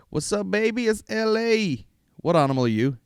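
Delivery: put-on high, swaggering "what's up baby"; hammed-up high-pitched swagger; cocky LA voice. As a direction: ham it up as a cocky bro. Voicing LA team.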